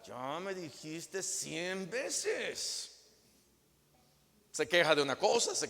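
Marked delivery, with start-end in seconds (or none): 0.0-2.9 weary brush-off. Acted. Husband's fed-up reply.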